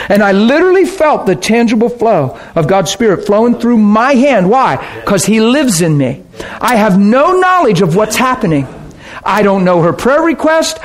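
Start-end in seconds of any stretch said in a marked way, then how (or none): none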